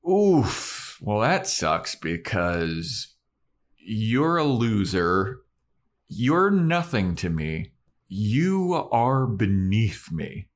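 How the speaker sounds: slow, high-pitched put-down; retold rejection, drawn out word by word; scornful Cecily. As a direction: imitate a cruel brush-off, stretching each phrase. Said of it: "And Cecily is like".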